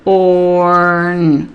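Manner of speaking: "orn" held long on one steady note; segmented sound. Shown as a demonstration. Teacher sounding out corn for a child, drawn out. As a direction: stretch "orn" out as its own chunk. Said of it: "they need to hear it segmented".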